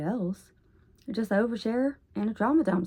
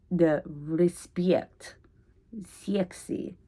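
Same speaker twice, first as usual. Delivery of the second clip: very drawn-out Russian-accented words; much slower than usual. Shown as a demonstration. Showing how you get the Russian, Russian accent. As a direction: draw each word out in a put-on Russian accent. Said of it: "That's how you get the Russian".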